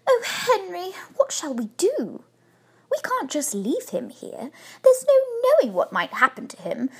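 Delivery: high, pleading wife; appealing. A narrator's reading. Wife begging her husband to take the bear in, appealing. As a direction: plead with rising urgency. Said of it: "She turned appealingly to her husband".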